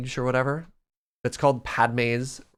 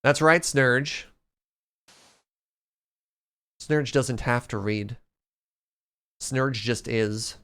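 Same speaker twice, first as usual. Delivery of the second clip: mock solemn; slower than usual. Grand pronouncement about Snurge.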